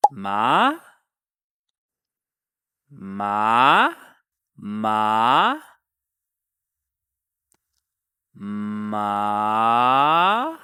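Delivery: each MA sweeps from low to very high; rising second tone. Shown as a demonstration. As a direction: start ma low, swoop up as high as possible. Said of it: "start low and go as high as you can".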